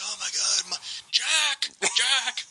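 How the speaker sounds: lightly hammed panicked calling; Bruce Wayne mock. Bruce Wayne in the ruined city calling for his friend, mock panic. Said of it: "he's like".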